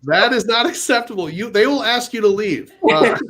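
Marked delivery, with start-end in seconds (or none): none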